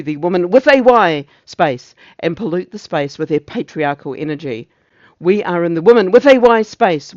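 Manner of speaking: declaimed tirade with sudden loud swells; ranting officer. Acted. Officer denouncing intruders in the women's space, ranting in swells. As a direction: hit the slogan hard, then settle back.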